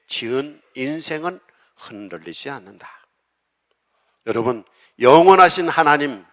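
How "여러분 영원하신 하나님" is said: loud, high cry; preacher crying out to the congregation at full force; preaching shout. Acted. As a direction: call out with full fervor.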